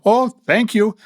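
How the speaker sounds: high-pitched little thanks, put on; imitating German lady. German lady thanking her helper before leaving, high put-on voice. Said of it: "she looked at me like".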